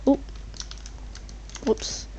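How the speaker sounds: ordinary talk, nothing marked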